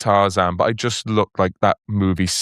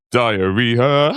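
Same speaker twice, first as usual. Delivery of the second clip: advert voice. Retold or imitated. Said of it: "have you seen the indigestion one".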